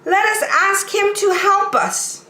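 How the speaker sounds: high, eager clown voice; excited little toy clown; funny little clown. Acted. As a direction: squeak it out like a cheerful toy clown. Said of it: "said the funny little clown".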